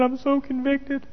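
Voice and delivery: high, slow, soft imitation of the wife; wife's voice. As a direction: mimic her contrite, small voice. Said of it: "She said".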